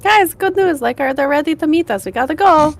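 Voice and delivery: high, bright rushing call to the group; excited call. Retold or imitated. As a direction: burst in with good news.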